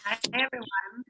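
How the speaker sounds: crunching chips while talking; mouth full. Real, not acted. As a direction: talk through a mouthful of chips. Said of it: "Excuse my crunching".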